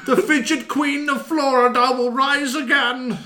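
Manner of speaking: high, loud royal proclamation; grand queen voice. Pretend.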